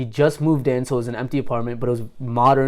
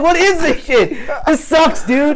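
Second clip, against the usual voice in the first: higher and louder, half laughing through the complaint; mock annoyed; 13 semitones higher, 4 dB louder, slower than usual. Retelling his bad trip to a friend, laughing mock annoyance. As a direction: complain like it's ridiculous, with a laugh. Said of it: "I started getting mad".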